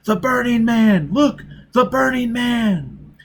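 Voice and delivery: high, loud panicked cry; terrified shout. Acted. Crowd on the stairs spotting the apparition, high terrified shouting. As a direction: scream it to the crowd.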